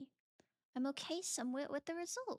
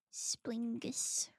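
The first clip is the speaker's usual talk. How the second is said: normal talking voice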